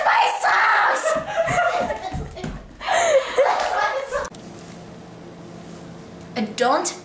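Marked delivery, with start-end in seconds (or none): 0.0-1.1 much higher, louder shriek; surprised shriek. Real, not acted. Spotting her socks, a real surprised shriek. 1.2-6.3 very high, breathy squealing laugh. Real, not acted. Cracking up after the socks shriek, squealing laugh.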